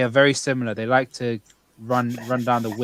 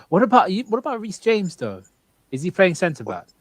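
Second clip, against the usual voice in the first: excited; 7 semitones higher, 3 dB louder than usual. Real, not acted. Sudden excited question.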